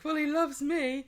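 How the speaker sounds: a high, hammed-up lovestruck woman; mock Abby. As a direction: say it high and dreamy. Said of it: "Abby's like".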